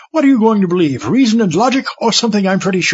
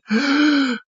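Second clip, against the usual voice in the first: gasp; 8 semitones higher than usual. Acted. Sees the mince pie.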